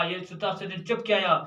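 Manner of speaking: ordinary talk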